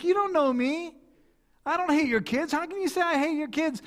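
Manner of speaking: high, indignant protest; mock offence. Insulted customer answering the seller, indignant and high. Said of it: "it'd be a bit insulting because I'm like".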